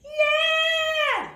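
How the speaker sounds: loud high belted yeah; belted shout. Shown as a demonstration. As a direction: belt it wide open. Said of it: "try it with me".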